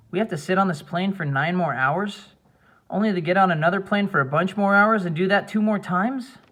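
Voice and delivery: put-on a restless kid griping about the long trip; whining boy.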